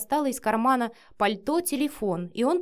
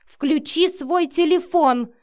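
loud, high, drawn-out order; angry hiss; 7 semitones higher, 8 dB louder, slower than usual. A narrator's reading. Matvey orders Vika through the camera, angrily. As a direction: press the order out slowly and angrily. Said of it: "прошипел в камеру Матвей".

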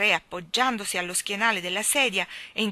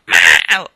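sudden loud screeching meow; wild cat yowl; 14 semitones lower, 20 dB louder than usual. A narrator's reading. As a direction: let out a savage cat cry. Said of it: "un grido selvaggio di gatto". (urlo selvaggio di gatto)

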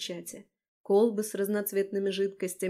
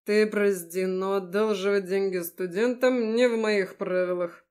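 trying to sound serious; 4 dB louder than usual. Acted. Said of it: "пытаясь выглядеть серьёзно".

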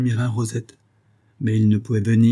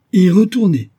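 very high, loud exclamation; incredulous echo; 10 semitones higher, 10 dB louder than usual. A narrator's reading. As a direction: explode at the absurd idea. Professor echoes answer in disbelief.

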